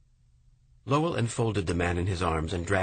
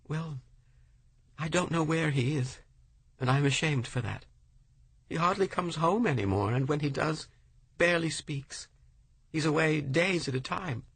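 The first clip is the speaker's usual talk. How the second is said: high, sorrowful woman's voice; ashamed widow; 8 semitones higher than usual. A narrator's reading. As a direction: admit it with shame, pitched high.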